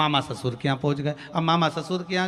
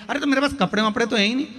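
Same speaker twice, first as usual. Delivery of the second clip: quick protest; 6 semitones higher, 4 dB louder, much faster than usual. Retold or imitated. Friend protests he lacks clothes.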